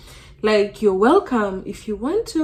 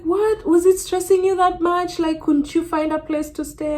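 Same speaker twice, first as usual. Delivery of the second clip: shocked concern; 9 semitones higher than usual. Retold or imitated. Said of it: "she's like".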